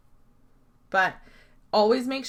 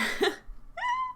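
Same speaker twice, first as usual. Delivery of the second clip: high squeaky laugh; 3 dB quieter than usual. Real, not acted. Laughs at own joke.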